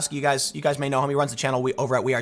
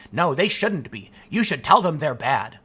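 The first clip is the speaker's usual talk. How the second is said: normal talking voice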